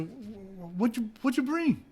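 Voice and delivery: high, stammered question; sputtering disbelief. Retold or imitated. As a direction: stumble in disbelief. Retold disbelief.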